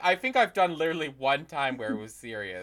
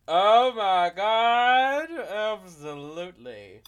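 gushing acceptance; 4 semitones higher, 5 dB louder, slower than usual. Pretend. Co-host asked to do the outro, hammed-up gushing. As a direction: gush it slowly, hammed-up.

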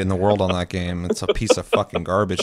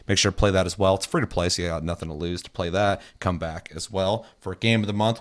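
usual speaking voice

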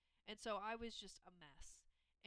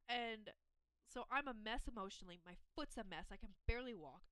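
ordinary talk

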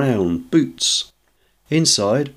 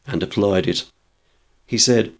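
ordinary delivery

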